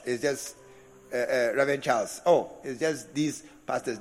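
low, offhand and dismissive; a scoffing churchgoer shrugging off pastors; dismissive mock voice. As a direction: brush the pastors off lightly. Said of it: "a certain familiarity".

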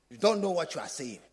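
ordinary delivery

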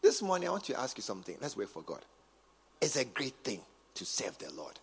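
ordinary talk

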